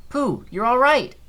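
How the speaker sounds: high, slow timid little pig voice; Piglet voice. Acted. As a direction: squeak it out like tiny Piglet. Voicing Piglet in cutscene.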